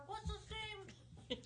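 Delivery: distant, faint voice from across the room; far off-mic. Pretend. Joking about doing the show from over there, far off-mic. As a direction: speak away from the microphone. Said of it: "did our show from over here".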